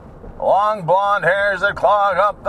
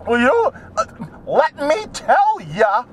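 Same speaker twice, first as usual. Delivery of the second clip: Liz Warren imitation; 5 semitones higher than usual. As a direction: draw out a high, hectoring impression. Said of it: "That's my Liz Warren imitation".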